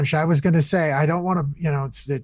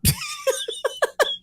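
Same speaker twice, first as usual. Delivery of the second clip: high laugh; 5 dB quieter than usual. Real, not acted. Laughs at own impression.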